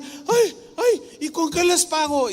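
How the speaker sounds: high, astonished cry of a humble woman; overjoyed disbelief. Retold or imitated. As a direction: gasp it out, unable to believe the gift. Overjoyed sister gets house back.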